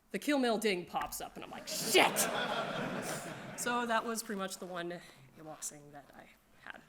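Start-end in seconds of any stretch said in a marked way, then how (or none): 1.7-2.3 loud, high laughing "Shit!"; startled curse. Real, not acted. Kill mail pops unexpectedly. 2.3-3.5 breathy laugh. Real, not acted. After the surprise kill.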